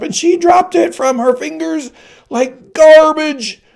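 very high, loud, slow hag voice full of contempt; a withered woman shaming the queen; accusing old crone. Acted. Old woman turning to the crowd, high and loud with contempt.

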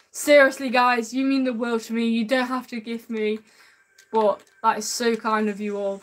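usual speaking voice

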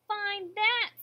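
loud, high-pitched taunt; sneering bird. A narrator's reading. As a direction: dare them scornfully. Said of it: "sneered the bird".

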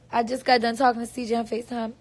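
usual speaking voice

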